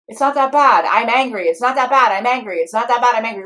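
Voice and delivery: loud, sing-song back-and-forth; mock argument, rapid alternation; bickering inner parts. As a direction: act out two parts squabbling in a loop. Two parts arguing back and forth.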